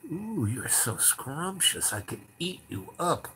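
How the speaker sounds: slow, soft, relishing creep; creepy savoring. Retold or imitated. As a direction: savor each word, imitating a creepy man. Retold creepy man savoring teller.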